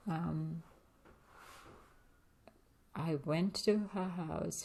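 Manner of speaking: ordinary talk, nothing marked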